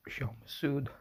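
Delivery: usual speaking voice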